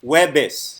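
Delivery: "Jueves" said the Tagalog way as "webis"; Tagalog pronunciation. Shown as a demonstration. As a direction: say "Jueves" like Filipino "huwebes". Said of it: "in the most Filipino accent".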